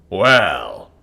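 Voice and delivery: loud booming king's voice. Acted. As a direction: boom it out to the hall. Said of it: "he booms".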